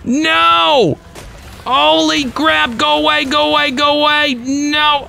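high-pitched rapid-fire pleading cries; frantic panic. Real, not acted. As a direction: beg it to back off in a panicked high voice. Frantic as attacker keeps coming.

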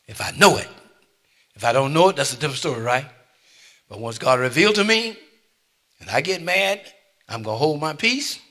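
loud, high preaching climax; preaching at full force. Real, not acted. Pastor testifying to his congregation, loud preaching. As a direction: cry it out to the church.

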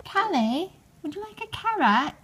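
high, playful mock-British voice; fancy British accent. Owner offering her pet a carrot in a fancy British accent. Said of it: "talk in a British accent".

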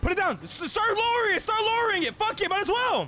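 high, loud frantic laughing panic; frantic excited. Real, not acted. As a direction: call it out in a frenzy. Panicked lowering during a chase.

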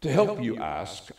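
normal talking voice

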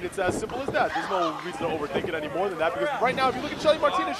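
ordinary talk, nothing marked

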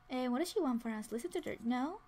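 normal talking voice

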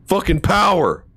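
ordinary talk

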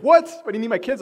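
sudden high startled question; caller's target suddenly alarmed about his kids. Retold or imitated. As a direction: jolt up in pitch, caught off guard. Victim alarmed by kids' info.

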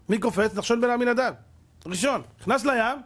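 ordinary delivery

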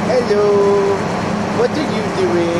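put-on a spooky kid speaking through a grown man; possessed kid voice. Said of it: "it's like he's been possessed by a kid".

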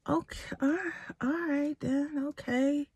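high, soft, airy resignation; subdued retold reply. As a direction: imitate him giving in, high and quiet. Retold grudging reply of the man.

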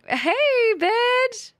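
high, loud cutesy greeting to her pet; high-pitched baby-talk hello; squeaky pet greeting. Acted.

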